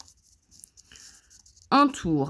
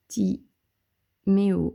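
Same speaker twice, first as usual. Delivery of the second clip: Timéo stretched slowly syllable by syllable as it is written; slow, drawn out; much slower than usual. Shown as a demonstration. Teacher slowly sounding out Timéo while writing it for pupils. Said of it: "alors là, j'ai fait un pont".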